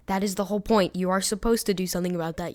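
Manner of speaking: normal talking voice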